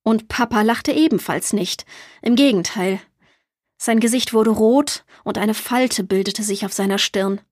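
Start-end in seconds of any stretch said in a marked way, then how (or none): none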